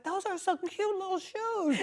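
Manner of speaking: very high, slow, sugary mock praise; fake sweet compliment. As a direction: gush over the shoes to dodge the baby. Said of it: "be like".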